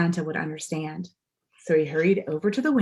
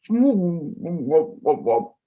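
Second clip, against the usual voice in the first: mumbled through a mouthful of pie; mouth full; 4 dB louder, much slower than usual. A narrator's reading. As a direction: talk round a full mouth. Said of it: "through a particularly tasteful mouthful of mince pie".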